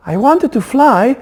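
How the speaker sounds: high, bright-voiced cry of longing; excited little eaglet exclaiming; eager exclamation. A narrator's reading. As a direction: blurt it out like a kid who wants to fly. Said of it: "exclaimed the first head".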